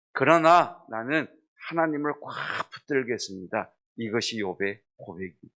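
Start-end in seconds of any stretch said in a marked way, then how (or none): 0.1-3.7 emphatic declaration. Acted.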